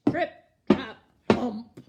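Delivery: loud thumping hooves; heavy loud hoofbeats. Acted. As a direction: stomp out each word.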